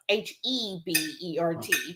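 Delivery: H E B E R T, each letter named apart; spelled letter by letter. Shown as a demonstration. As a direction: spell out "H E B E R T" one letter at a time. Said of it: "well, they spell it".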